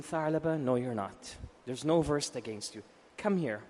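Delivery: low, soft, soothing reassurance; calm a distressed child, hushed and low; gentle comforting. Retold or imitated. The Prophet comforts a crying boy, tenderly. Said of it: "look at his style of how he's gonna comfort this child".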